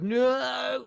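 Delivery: high, loud drawn-out "no"; mock-anguished shout; dismayed cry. Real, not acted. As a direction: wail it in exasperation at a setback. Dismayed at needing authenticator code.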